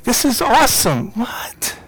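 high-pitched, thrilled reaction; excited disbelief. Retold or imitated. Husband reliving the news that she's pregnant again, excited disbelief. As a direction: re-enact being thrilled and amazed.

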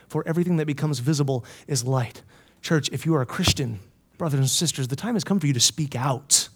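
low, soft preaching; hushed, low charge to the congregation; solemn appeal. Real, not acted. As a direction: urge them quietly and earnestly.

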